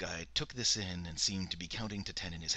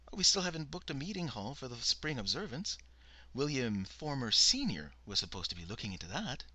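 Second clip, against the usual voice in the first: lightly voiced fussy woman, higher; a hint of a prim secretary reporting; Bernice, fussy secretary; 6 semitones higher, 4 dB louder than usual. A narrator's reading. Bernice's voice in the story.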